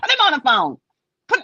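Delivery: exasperated acted command; loud demand. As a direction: demand it loudly and impatiently. Acted demand to the child's mother.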